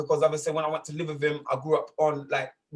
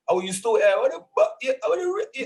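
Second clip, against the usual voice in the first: mocking voice; 9 semitones higher, 5 dB louder than usual. Mock voice of a relative.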